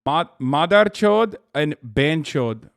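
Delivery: "Madar Chod and Ben Chod" slow and loud, in Hindi sounds; careful Hindi pronunciation. Shown as a demonstration. Streamer double-checking Hindi words with chat, slow careful pronunciation. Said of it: "trying to say words correctly".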